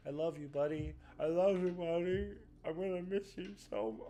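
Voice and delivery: tearing up while saying goodbye to the dog; about to cry. Real, not acted. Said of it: "I'm gonna cry".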